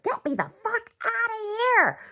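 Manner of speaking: very high, strained screech as the dog; frantic dog voice. Retold or imitated. Dog begging to get out of the car, frantic high screech. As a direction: screech it like a panicking animal. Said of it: "The dog's like".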